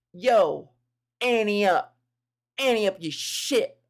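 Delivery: loud, drawn-out gunman demand; mugger impression. Gunman holding up a lone walker, loud mugger impression. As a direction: put on a mugger's accent, punching each phrase. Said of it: "That accent, that impression".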